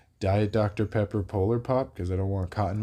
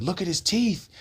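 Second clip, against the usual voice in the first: high imitated accusation; mock screaming; 8 semitones higher than usual. Crackhead accusing the other man of smoking meth, high mock scream. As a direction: mimic an accuser yelping, pitched up. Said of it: "screaming at him".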